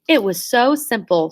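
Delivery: ordinary delivery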